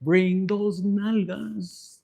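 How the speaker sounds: very slow, stretched-out lecherous call; Papi Infinite. Acted. Papi Infinite summoning a lover, slow drawn-out voice. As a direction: draw every word out like a lusty summons. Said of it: "This is fucking Papi Infinite".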